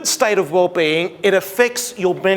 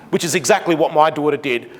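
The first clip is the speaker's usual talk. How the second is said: ordinary talk, nothing marked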